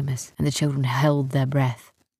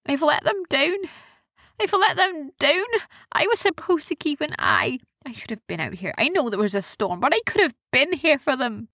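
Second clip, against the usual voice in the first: high-pitched self-reproach, dipping then rising; sad; 11 semitones higher than usual. A narrator's reading. Erin drops to her knees over her lost friends, high and sad. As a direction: blame yourself in a high, upset voice. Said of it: "Erin looked sad".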